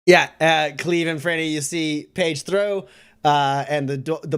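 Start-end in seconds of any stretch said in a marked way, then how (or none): none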